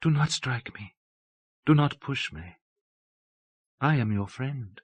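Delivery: put-on soft, childlike appeal; strange innocence. A narrator's reading. Abbess barring the door to Torval, strangely innocent. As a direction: plead softly and innocently, leaving pauses. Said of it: "still with that strange innocence".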